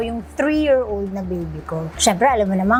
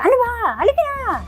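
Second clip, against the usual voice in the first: much higher, mock nagging voice; high put-on voice of an impatient nanny; imitating the scolding nanny; 12 semitones higher than usual. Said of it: "naririnig ko na na medyo".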